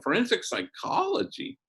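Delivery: high rising amazed echo, pitch climbing well up; impressed surprise. Retold or imitated. Priest impressed by the daughter's college major, echoing high and amazed. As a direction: savor the words like they're a big deal. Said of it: "And I go, "Damn".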